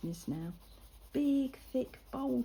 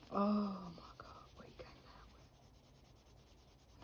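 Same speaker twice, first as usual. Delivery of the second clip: slow, hushed disbelief; aghast whisper; 8 dB quieter, much slower than usual. Real, not acted. Recalling the lad's rude talk, hushed and slow. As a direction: barely breathe it, scandalised.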